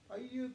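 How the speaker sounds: Ayukwi lifted high, greeting a place. Shown as a demonstration. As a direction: call Ayukwi out tenderly to the land. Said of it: "you can tell that place".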